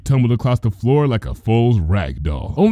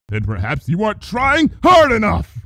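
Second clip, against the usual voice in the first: snarling; 10 semitones higher than usual. A narrator's reading. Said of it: "Luna snarled".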